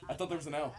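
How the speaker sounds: usual speaking voice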